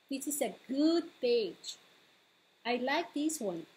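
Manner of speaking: ordinary delivery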